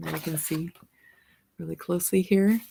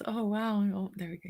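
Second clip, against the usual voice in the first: plain reading voice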